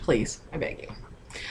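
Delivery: breathy whispered plea, barely audible; a playful hushed beg to the viewers; mock pleading whisper. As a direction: beg in a tiny joking whisper. Joking plea not to be judged.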